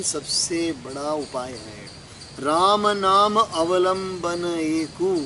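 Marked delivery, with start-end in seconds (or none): 2.4-5.1 slow, half-chanted scripture line; verse recitation. Real, not acted. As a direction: intone the verse slowly. Recites verse before explaining it. (धीमे लय में चौपाई पाठ)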